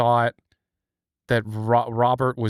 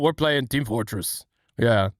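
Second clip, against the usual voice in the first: ordinary talk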